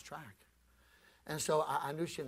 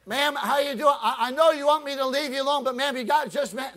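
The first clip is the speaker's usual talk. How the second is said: loud, higher, coaxing call; 12 semitones higher, 13 dB louder than usual. Retold or imitated. Preacher pleading through the screen door, loud and coaxing. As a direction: coax her through the door at full voice.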